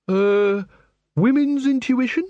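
high, tentative query; hesitant, high-voiced offer; hesitant suggestion. A narrator's reading. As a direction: venture it uncertainly, pitched well up. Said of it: "she suggested".

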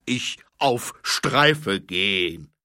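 very slow, loud troll voice; offended troll growling at the sergeant. Acted. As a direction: rumble each word like a troll. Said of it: "grollte er".

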